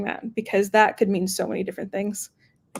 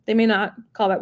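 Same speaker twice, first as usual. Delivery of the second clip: normal talking voice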